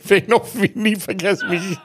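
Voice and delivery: high, laughing recollection. Real, not acted. Telling a colleague an unforgettable memory, laughing. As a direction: say it through real laughter. Laughs while recalling the remark.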